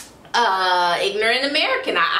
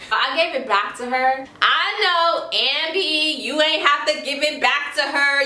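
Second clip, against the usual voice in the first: usual speaking voice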